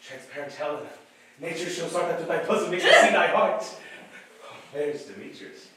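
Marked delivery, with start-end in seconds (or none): 1.4-3.6 very loud, laughing with giddy delight; rapturous. Acted. Enchanted Lysander praising Helena, loud giddy rapture. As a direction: swoon at her out loud.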